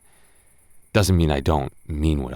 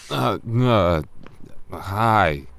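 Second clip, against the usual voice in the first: normal talking voice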